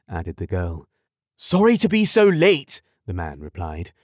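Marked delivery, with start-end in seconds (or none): 1.4-2.8 loud, high hearty dad; hearty father voice. A narrator's reading. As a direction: apologise heartily. Father apologises to couple.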